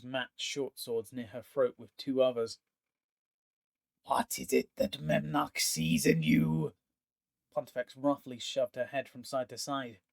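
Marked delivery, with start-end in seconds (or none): 4.0-6.7 slow, menacing interrogation of a captive; menacing villain. Acted. Pontifex sneering at the pinned elf, slow and menacing. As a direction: lean in and sneer each word.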